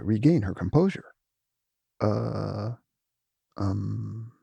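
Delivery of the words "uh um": soft hesitant stammer; falter, lost for words; quiet nervous stammer. Acted. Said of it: "she stammered".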